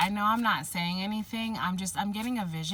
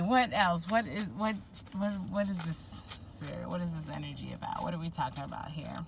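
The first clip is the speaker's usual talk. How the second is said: usual speaking voice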